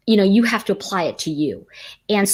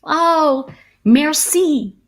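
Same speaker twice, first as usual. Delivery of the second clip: high, bright fake-confident thanks; sheepish merci; 8 semitones higher, 3 dB louder, much slower than usual. Tourist bluffing a thank-you to the stranger, high and sheepish. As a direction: nod along pretending to understand. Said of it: "I just nodded".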